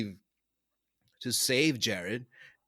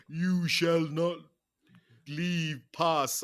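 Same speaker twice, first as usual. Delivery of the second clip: slow, grand mock Gandalf decree; mock wizard decree; 4 semitones higher, much slower than usual. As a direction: stretch it out like a wizard blocking the way. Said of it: "he said".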